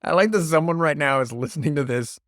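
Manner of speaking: ordinary delivery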